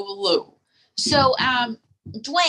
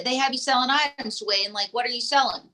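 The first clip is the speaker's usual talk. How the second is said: usual speaking voice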